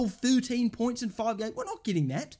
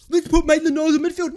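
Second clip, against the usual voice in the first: much louder, sarcastic imitation of a bad idea; loud mocking quote; 4 semitones higher, 10 dB louder, faster than usual. Fan mimicking the manager's midfield decision, loud and scornful. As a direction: throw the manager's plan out loudly.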